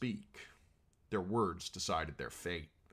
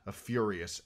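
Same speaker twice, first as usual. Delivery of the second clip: usual speaking voice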